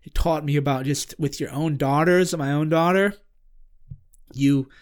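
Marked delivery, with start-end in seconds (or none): none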